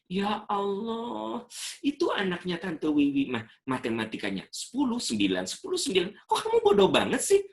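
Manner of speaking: put-on an exasperated mother berating her child over a six in math; scolding mother. Mother berating her child over a bad math grade, high and rising to a shriek.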